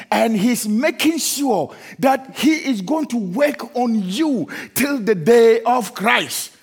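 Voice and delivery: rising, emphatic pulpit delivery; emphatic sermon cadence building to a refrain; preaching. Acted. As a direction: preach it with full conviction. Preaching building over music.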